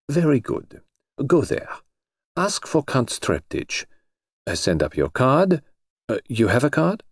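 measured, slightly foreign instructions; foreign colonel. A narrator's reading. The Colonel instructing Jane about next steps, formal.

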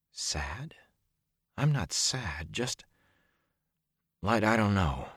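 breathless whispered question; half under the breath, disbelieving. A narrator's reading. Perrin protests to himself in a whisper.

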